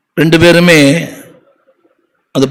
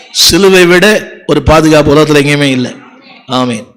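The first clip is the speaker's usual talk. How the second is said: ordinary talk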